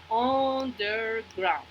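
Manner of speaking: "underground" slowly as un, der, ground; sílaba por sílaba. Shown as a demonstration. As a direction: stretch "underground" into three separate beats. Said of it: "haciendo un solo sonido". ("underground" despacio, separado en sílabas)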